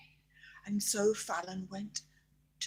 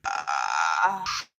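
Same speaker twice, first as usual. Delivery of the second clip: dragon roar; 5 dB louder than usual. Acted. Dragon finally blows fire.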